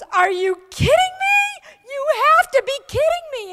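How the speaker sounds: high, loud incredulous cry of disbelief; incredulous shout. Retold or imitated. Boss shouting in disbelief at her new officer, incredulous and high. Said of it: "And she goes".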